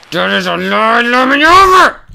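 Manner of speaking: rising to a high outraged shout; frustrated yell. Real, not acted. Gamer shouting at his game, high outraged yell. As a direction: yell at the game to let you over.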